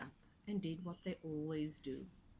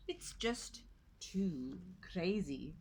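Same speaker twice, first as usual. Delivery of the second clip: put-on slow old goat; much slower than usual. A narrator's reading.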